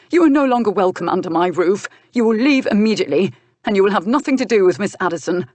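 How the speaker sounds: loud, fast, rising orders; angry command. Acted. Young duke banishes his uncle, fast angry commands. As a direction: rattle off the orders with rising fury.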